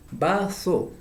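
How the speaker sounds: Vaso said with a lisped th, baθo. Shown as a demonstration. Teacher contrasting vaso spelled with Z. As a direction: say Vaso with Spanish Z, th sound. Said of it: "con B larga y Z".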